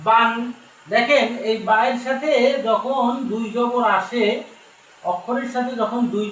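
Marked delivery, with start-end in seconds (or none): 0.1-0.3 বান with the alif silent and an n close; teacher models বান; tanween sound. Shown as a demonstration. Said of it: "বা আলিফ দুই জবর".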